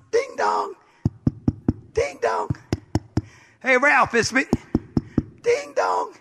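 high sung doorbell chime; doorbell ding dong. Retold or imitated. Imitating a doorbell.

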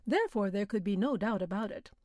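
usual speaking voice

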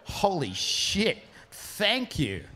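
stunned and grateful, let it out slowly; awed thanks. Retold or imitated. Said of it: "And I go".

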